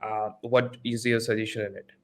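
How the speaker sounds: ordinary talk, nothing marked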